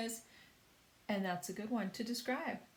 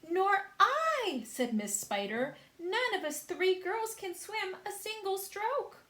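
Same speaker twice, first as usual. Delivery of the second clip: high, loud spider lady voice; Miss Spider; 11 semitones higher, 6 dB louder than usual. A narrator's reading. Miss Spider fretting that none of the girls can swim. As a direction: fret aloud in a high voice.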